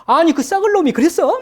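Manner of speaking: a gossiping friend chimes in with shocked indignation; indignant agreement. Retold or imitated. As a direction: egg the friend on, outraged. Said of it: "맞장구 치면서".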